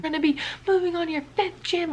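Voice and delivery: high-pitched imitation of a screamed threat; mock threatening scream. Coach threatening to kick gymnast out, mock scream. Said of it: "screaming at the top of his lungs".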